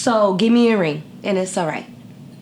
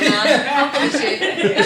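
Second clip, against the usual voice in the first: laughing imitation; 3 semitones higher, slower than usual. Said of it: "He was like".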